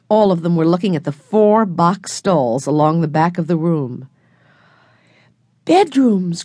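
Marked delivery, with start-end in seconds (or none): 5.6-6.4 higher, thrilled exclamation; a small boy discovering his bed; excited cry. A narrator's reading. Benny to the others in the barn, high and thrilled. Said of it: "cried Benny".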